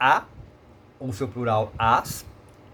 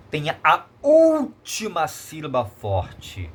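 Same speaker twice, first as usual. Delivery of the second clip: "última" drawn out long and raised; stress on última; 2 semitones higher, much slower than usual. Shown as a demonstration. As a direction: stretch the first vowel of "última", slow teacherly emphasis. Teacher stressing the key rule.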